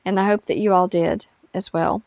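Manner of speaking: ordinary talk, nothing marked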